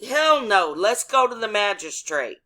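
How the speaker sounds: high, loud, emphatic refusal; defiant retold refusal. Driver refusing the ticket and demanding the magistrate, defiant.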